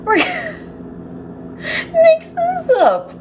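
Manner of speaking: high-pitched squeal of helpless laughter; laughing uncontrollably. Real, not acted. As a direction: squeeze the words out through a real laughing fit. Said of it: "I don't know why I'm laughing".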